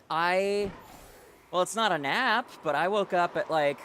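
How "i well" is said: normal talking voice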